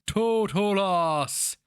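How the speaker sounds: high, loud, slow incantation cry; sorcerer's final spell word. A narrator's reading. Sorcerer finishing the spell, slow loud incantation. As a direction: stretch out the last word of power. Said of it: "The first of the sorceress' words twisted sound".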